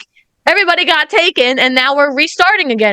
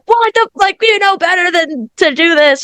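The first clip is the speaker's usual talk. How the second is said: mock scolding fan; 6 semitones higher than usual. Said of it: "we're just like".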